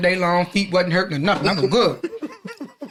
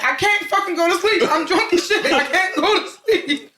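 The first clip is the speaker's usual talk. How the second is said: put-on high, dragged-out drunk wail through laughter; friend's text voiced as a high, slow drunk whine; mock drunk complaint; 11 semitones higher, slower than usual. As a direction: whine it slow like you're wasted and wide awake. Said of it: "Keith was texting me".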